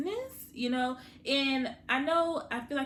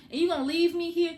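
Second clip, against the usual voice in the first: higher and louder, hurt plea; pleading sister; 7 semitones higher, 6 dB louder, faster than usual. Retold or imitated. Sister begging her brother not to leave, raised hurt plea. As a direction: push the question up, upset. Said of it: "And she's like".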